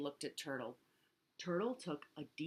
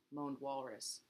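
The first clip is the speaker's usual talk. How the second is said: ordinary delivery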